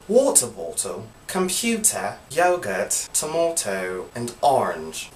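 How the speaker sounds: second English accent, crisp t in yogurt, tomato as tomahto; second English accent attempt. Shown as a demonstration. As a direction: try another English accent on the list. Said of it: "I'm gonna try two different ones".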